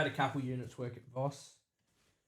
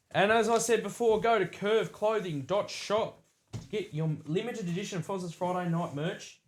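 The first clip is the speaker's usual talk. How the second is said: higher, louder promo announcer; ad read; 6 semitones higher, 5 dB louder than usual. Acted. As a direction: sell it like a radio ad. Merch plug read.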